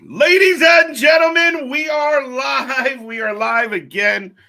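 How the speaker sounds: announcer shout. Acted. Show opening hype announcement.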